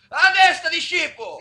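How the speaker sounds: loud, slow threat; angry threat. Acted. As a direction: bark the threat. Threatening the instructor.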